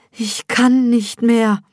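verzweifelt flehend. A narrator's reading. Gebärende am Ende ihrer Kraft.

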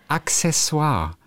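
Accessoire slowly, as Ak-sessoire; correct K-S sound. Shown as a demonstration. Podcaster presents a word, slowly as Ak-sessoire. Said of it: "Es heißt Accessoire".

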